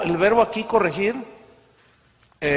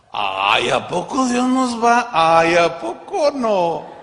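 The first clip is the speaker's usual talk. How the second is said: mock disbelief; 5 dB louder, slower than usual. Mocking doubters of God's punishment.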